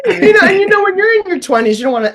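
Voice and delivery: high, loud, tearful laughing; laughing through tears; laugh-crying. Real, not acted.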